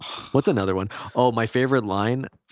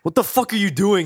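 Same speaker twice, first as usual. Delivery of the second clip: high, raised mock-angry voice; imitated outrage; 9 semitones higher, 4 dB louder than usual. Wife catching husband with baby monitor, mock outraged. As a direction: scold him as the appalled wife.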